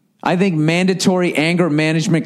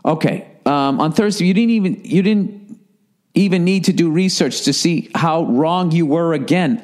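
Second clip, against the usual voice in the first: usual speaking voice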